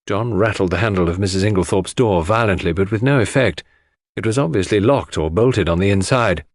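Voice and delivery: ordinary delivery, nothing marked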